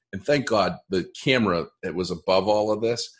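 ordinary delivery

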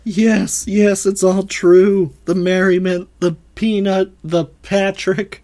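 high, loud acted outburst; frantic confession. Suspect admitting all to interrogators, frantic high confession. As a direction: crack and confess it all, loudly.

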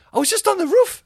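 very high, loud squawk of protest; outraged mock shout. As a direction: protest in a shrieky, exasperated voice. Retold outraged complaint to staff.

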